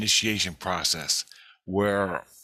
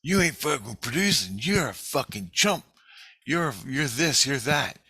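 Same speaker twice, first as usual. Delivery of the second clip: mock scream, higher voice with little added volume; half-raised accusing yell; retold screaming accusations; 6 semitones higher than usual. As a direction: imitate a crowd hurling accusations, pitched up. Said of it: "everybody's screaming at me".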